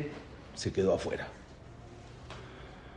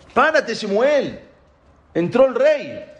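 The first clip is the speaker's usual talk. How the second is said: high, drawn-out acted command; 10 semitones higher, 4 dB louder, slower than usual. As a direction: proclaim it high and slow, ordering him up. God commands prophet to stand.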